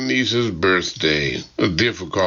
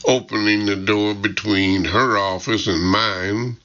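plain reading voice

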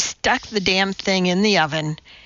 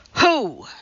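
higher, demanding one-word question; commanding; 6 semitones higher than usual. A narrator's reading. Teacher demands answer from silent class, commanding. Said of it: "she commanded".

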